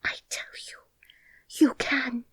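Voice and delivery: urgent high whisper; girl whispering encouragement to herself. A narrator's reading. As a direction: breathe the words under her breath. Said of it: "said Mary to herself".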